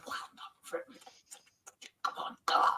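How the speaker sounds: tense whisper urging the team on. Real, not acted. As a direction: breathe the words to the screen.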